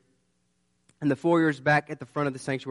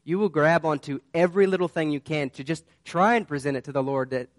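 ordinary delivery

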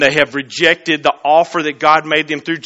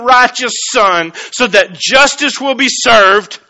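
higher, louder preaching voice; fervent preaching; 5 semitones higher, 4 dB louder than usual. Acted. Pastor telling the church of God's plan, fervent preaching. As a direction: lift it and drive it home.

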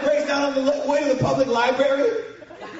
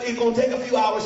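usual speaking voice

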